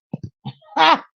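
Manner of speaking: loud, high-pitched cackle of disbelief; high burst of laughter. Real, not acted. To viewers about church women, a shrieking cackle.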